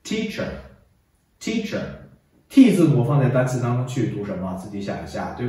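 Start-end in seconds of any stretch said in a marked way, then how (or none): 0.0-1.8 slow read-along "teacher"; 领读示范. Shown as a demonstration. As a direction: model the word slowly, leaving a gap to repeat. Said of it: "跟我一起读". (慢速领读单词)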